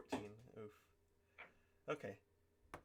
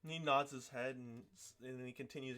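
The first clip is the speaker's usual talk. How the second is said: ordinary talk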